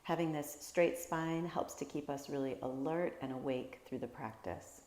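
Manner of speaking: normal talking voice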